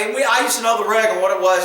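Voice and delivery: normal talking voice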